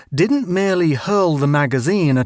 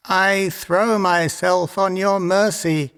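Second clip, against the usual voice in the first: tiny voice; 5 semitones higher, slower than usual. A narrator's reading. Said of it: "a tiny voice said".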